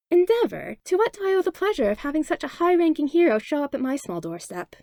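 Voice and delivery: mock-sweet greeting to an unwelcome hero. A narrator's reading. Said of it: "Inko asked sweetly".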